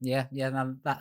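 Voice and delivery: usual speaking voice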